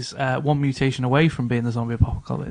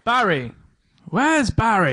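high, calling out for a lost teammate; hammed-up video game call for Barry; 7 semitones higher, slower than usual. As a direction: shout it like a game intro line. Quoting Resident Evil intro.

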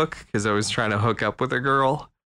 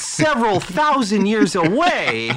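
exasperated God; 7 semitones higher than usual. Retold or imitated. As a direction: beg her to hold off, flustered.

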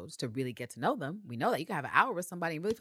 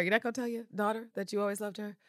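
ordinary talk

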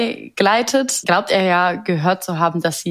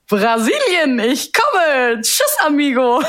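excited snake voice; 8 semitones higher, much slower than usual. Retold or imitated. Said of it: "Die sagt".

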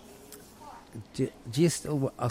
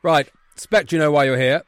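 loud, raised headmaster voice; stern headmaster; 5 semitones higher, 5 dB louder than usual. Acted. Headmaster calling two boys into his office, stern. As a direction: summon them sharply, full voice. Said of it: "One is the Wrexham Headmaster's Office".

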